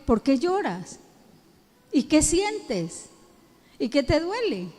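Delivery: tender comforting voice; gentle caring question. Shown as a demonstration. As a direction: lean in and ask softly. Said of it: "Hay que decirle".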